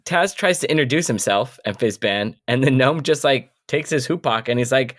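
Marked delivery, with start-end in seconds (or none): none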